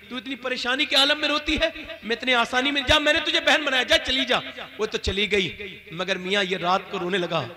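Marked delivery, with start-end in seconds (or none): 0.1-4.4 emotional outburst. Acted. Kifl moved, sends girl away.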